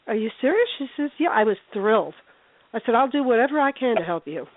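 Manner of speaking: ordinary talk, nothing marked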